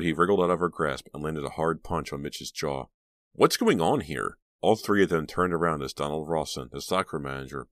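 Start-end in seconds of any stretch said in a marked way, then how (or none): 3.3-4.4 loud, slow demand; stern demand. A narrator's reading. Stock manager walks in on fighting boys, stern demand. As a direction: burst in demanding an explanation.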